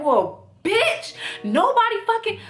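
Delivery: high, exploding retold outburst; going off. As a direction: snap from chill into cussing him out. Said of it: "I literally went from being so chill".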